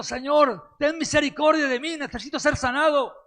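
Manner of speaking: preacher's impassioned mock beggar's shout; acted cry for mercy. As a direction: cry out to the Lord in desperate need. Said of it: "yo grito".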